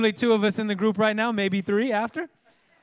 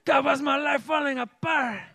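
high, melodramatic mock-Italian cry; hammy Italian accent; 6 semitones higher than usual. Preacher replaying his self-pity joke, hammy Italian-style accent.

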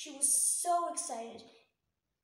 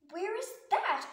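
alarmed question; 5 semitones higher, 6 dB louder than usual. Acted. Lucy asks princess.

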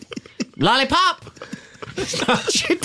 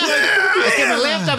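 high excited shout; shouting coach; 7 semitones higher than usual. Acted.

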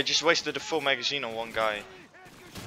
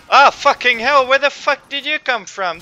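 loud furious yelling at a surprise attack; high, loud burst of real anger; angry shout; 7 semitones higher, 7 dB louder than usual. Real, not acted. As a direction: yell it out, caught off guard. Ambushed by enemy in game.

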